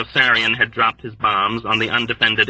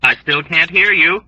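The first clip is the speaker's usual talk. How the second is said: tolerant complaint; 6 semitones higher, 5 dB louder than usual. A narrator's reading. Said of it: "Arfie complained tolerantly".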